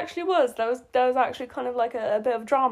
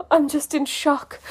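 higher-pitched, melodramatic astonishment; mock shock; 6 semitones higher than usual. Reader mocks Marshall's reaction, in fake shock. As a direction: overact being stunned. Re-enacts melodramatic line between gasps.

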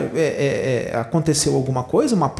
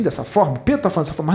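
ordinary talk, nothing marked

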